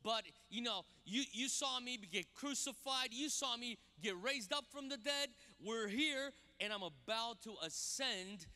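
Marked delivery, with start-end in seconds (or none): none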